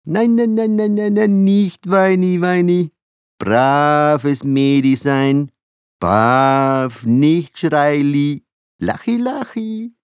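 put-on very high, slow baby-talk soothing; high-pitched baby-talk hush. A narrator's reading. Father baby-talks to crying infant.

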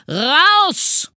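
very loud, high scream; furious yell. A narrator's reading. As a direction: scream it, red-faced with rage. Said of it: "schrie Herr Studienrat Groll mit hochrotem Kopf". (wütend herausgeschrien)

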